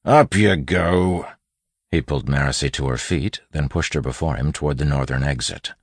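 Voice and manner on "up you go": slow, loud, raised bandit voice; bandit giving orders. A narrator's reading. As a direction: order the captive up. Bandit hauls captive up.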